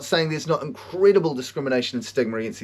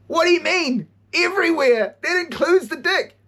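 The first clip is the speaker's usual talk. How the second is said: put-on a horrified kid, pitched way up; shocked disbelief; 13 semitones higher than usual. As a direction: recoil in horror at the news. Kid hearing shocking news.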